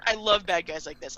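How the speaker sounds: normal talking voice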